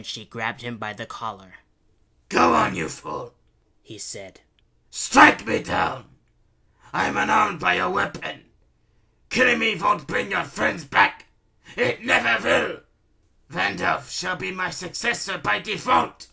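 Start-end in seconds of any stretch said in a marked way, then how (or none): 2.3-3.3 loud, raised villain voice; goading villain. A narrator's reading. Baron grabbed by the collar, goading. Beaten villain goads the heroine. 4.9-16.3 high, loud, defiant villain voice; goading villain. A narrator's reading. Unarmed Baron daring Princess Kitty to strike, goading. Beaten villain dares her to kill.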